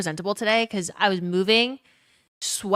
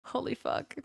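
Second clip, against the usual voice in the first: laughing whisper; 4 semitones higher, 9 dB quieter than usual. Real, not acted. Stunned by a chat message.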